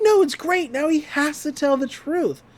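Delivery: voicing Mabel. Mabel voiced in retelling.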